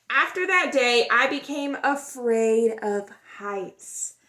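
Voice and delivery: expressive storybook read-aloud; gentle, lively storytime voice; reading to children. A narrator's reading. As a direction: tell it like a picture book for kids. Reads picture book aloud.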